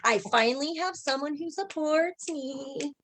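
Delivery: put-on slow, rising, high little-girl delight; thrilled inner child. Inner child finally gets support for the stage, delighted high drawl. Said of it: "She's like".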